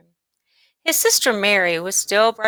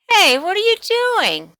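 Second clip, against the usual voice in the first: much higher, louder sharp demand; 12 semitones higher, 5 dB louder than usual. Acted. Sister catching her brother eating toothpaste, demanding. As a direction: call him out, shocked and bossy. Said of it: "she demanded".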